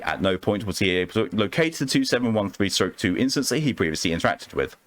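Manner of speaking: usual speaking voice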